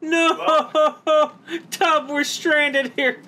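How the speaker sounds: high, laughing cries of no; laughing disbelief. Real, not acted. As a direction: crack up in dismay, slowly. Ship destroyed, laughing disbelief.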